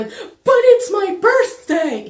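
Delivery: put-on loud, very high childish wail; mock child's tantrum. What the kid is not saying, hammed-up tantrum. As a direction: whine it at the top of the voice like a spoiled kid. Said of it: "the kid's not going".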